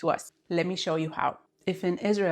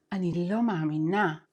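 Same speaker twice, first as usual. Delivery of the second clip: ordinary delivery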